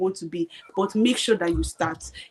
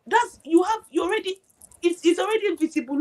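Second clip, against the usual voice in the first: much higher, pushing her to go for it; rising excitement, pitched way up; excited urging; 10 semitones higher than usual. Real, not acted. Urging friend toward her passion.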